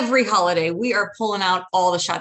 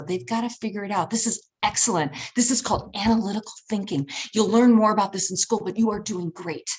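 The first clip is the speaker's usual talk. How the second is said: ordinary talk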